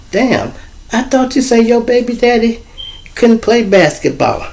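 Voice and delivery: lightly voiced teasing girl; teasing friend.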